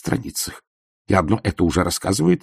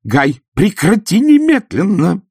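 much higher, louder, slow stern order; sharp command; 12 semitones higher, 8 dB louder, slower than usual. Acted. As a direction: stop him at once. Wife commanding husband stop.

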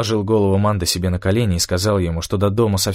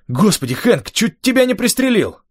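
high, agitated outburst; agitated exclamation; 13 semitones higher than usual. A narrator's reading. Rafael to Hank after nearly shooting him, agitated cry. Startled friend nearly shot narrator.

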